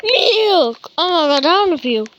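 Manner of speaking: normal talking voice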